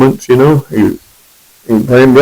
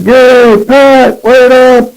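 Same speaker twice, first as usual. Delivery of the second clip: yelling across church; slower than usual. Retold or imitated. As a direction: shout their names over the crowd. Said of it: "he yelled out".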